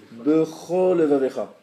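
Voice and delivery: "Bechol Levavecha" with one lamed swallowed, loud; words run together. Shown as a demonstration. Teacher showing what hasty reading gives, words run together. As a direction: merge "Bechol Levavecha" into one word, a single L between. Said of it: "si vous lisez vite, ça donne".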